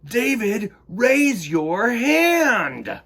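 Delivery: loud, slow scolding; stern teacher. A narrator's reading. Teacher telling David off for gum, slow and stern. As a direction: spell out each word like a strict teacher.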